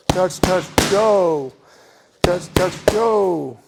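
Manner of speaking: "go" called out loud and sharp; light "touch, touch", barked "go"; stress on the last punch. Shown as a demonstration. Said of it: "emphasis on the last punch".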